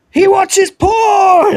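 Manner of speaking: high, very slow, laughing shout. Real, not acted. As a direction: yell it out then burst laughing. Shouting at clip reveal.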